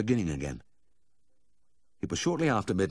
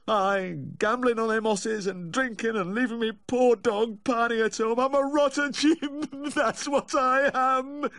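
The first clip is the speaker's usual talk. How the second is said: very high, slow blubbering wail; hammed-up maudlin sobbing; moaning self-reproach; 4 dB louder, slower than usual. A narrator's reading. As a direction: wail your guilt in drunken remorse. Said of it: "Humphrey, sniffing and moaning".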